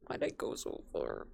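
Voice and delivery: breathy muttered question; whisper. Real, not acted. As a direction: whisper it low to yourself. Whispered to herself.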